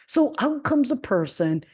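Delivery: ordinary delivery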